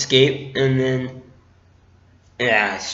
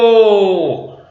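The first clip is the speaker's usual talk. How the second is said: long, drawn-out tantrum wail; mock a melodramatic cry of anguish, pitched up; Darth Vader; 4 semitones higher, 4 dB louder than usual. Fan imitating the end of the prequels, wailing tantrum. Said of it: "his temper tantrum at the end".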